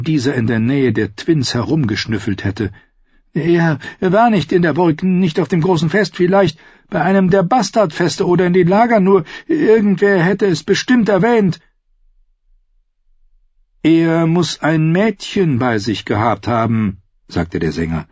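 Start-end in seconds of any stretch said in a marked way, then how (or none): 3.3-11.6 high, halting, nervous answer; anxious captive. Acted. Captive searches his memory for the outlaws, anxious and high-pitched. 13.8-17.0 singer outlaw. Acted.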